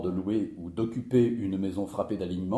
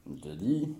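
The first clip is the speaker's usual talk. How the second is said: ordinary talk, nothing marked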